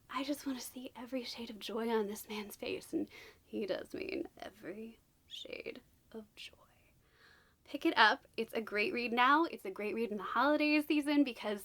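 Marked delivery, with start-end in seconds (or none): 0.1-6.6 soft swooning whisper. Real, not acted. Vlogger quotes a romantic line to viewers, whispering. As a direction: breathe it like a swoony secret.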